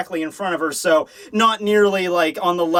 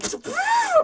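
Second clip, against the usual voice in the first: high laugh burst. Real, not acted. Laughing at the pureeing.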